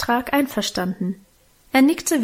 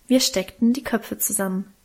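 usual speaking voice

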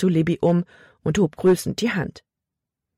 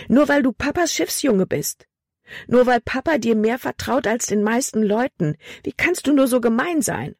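higher-pitched, indignant outburst; speaking out loud; 7 semitones higher than usual. A narrator's reading. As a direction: vent the grievance in a raised pitch. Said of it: "dass sie laut sprach". (empört und mit höherer Stimme laut schimpfen)

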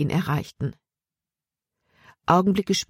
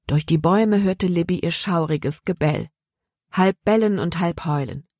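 usual speaking voice

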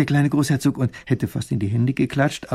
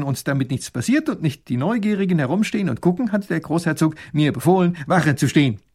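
put-on dutiful young soldier, higher and louder; 5 semitones higher, 4 dB louder than usual. Soldier reports proudly to the princess, eager and dutiful. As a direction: report eagerly like a loyal guard. Soldier explains old pond.

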